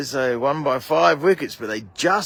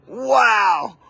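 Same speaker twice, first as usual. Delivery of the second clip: amazed wow; 5 semitones higher, 4 dB louder than usual. Real, not acted. Reacting to blooper replay.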